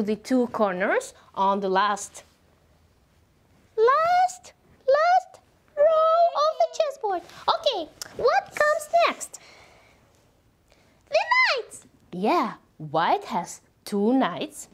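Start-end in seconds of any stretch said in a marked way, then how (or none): 3.8-9.4 high, bright sing-song teacher voice; kindergarten teacher voice. Acted. 11.1-11.9 high excited announcement. Acted.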